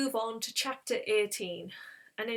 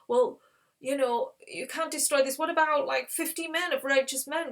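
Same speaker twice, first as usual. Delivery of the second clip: raised, pushy haggling on another's behalf; protest, then float an offer; pleading bargaining; 5 semitones higher, 4 dB louder than usual. Retold or imitated. Abraham bargains for Sodom.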